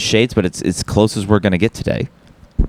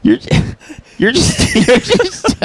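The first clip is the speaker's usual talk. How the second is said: laughing; 10 semitones higher than usual. Real, not acted.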